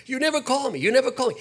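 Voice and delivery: high, nagging mom voice; mock nagging mother; imitating a mother. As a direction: pile on the guilt like a mother. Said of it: "she'd forget that I would call her".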